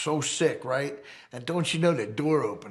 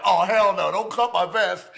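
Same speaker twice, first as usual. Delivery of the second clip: louder, higher hell no; protesting; 7 semitones higher, 8 dB louder than usual. Retold or imitated. Hurt biker stopping paramedics cutting his vest, loud protest. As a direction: refuse hard, protect the new vest.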